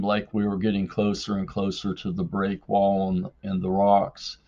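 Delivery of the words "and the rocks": usual speaking voice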